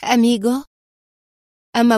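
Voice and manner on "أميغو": lightly voiced glad exclamation; happy, warm cry. A narrator's reading. Mariana greeting Adham as he arrives, happily exclaiming. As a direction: greet him with a touch of joy. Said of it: "فهتفت في حرارة وسعادة".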